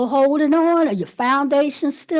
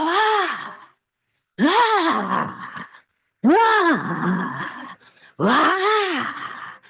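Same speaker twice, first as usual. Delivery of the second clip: crying out ah; 3 semitones higher, much slower than usual. Shown as a demonstration. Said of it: "just go".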